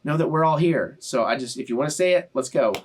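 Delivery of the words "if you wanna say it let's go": normal talking voice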